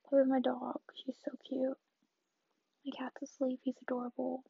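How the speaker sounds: ordinary talk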